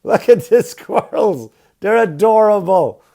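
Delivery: high, loud, slow gushing over the squirrels; hammed-up baby-talk delight; mock cooing. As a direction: coo like a doting onlooker.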